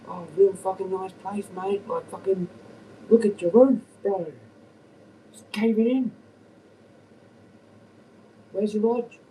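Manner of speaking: mock rant. Taunting the landlord about his roof and lodge, high sneering rant. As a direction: jeer at the neighbour's house way up high. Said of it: "Imagine if I was doing that when he came".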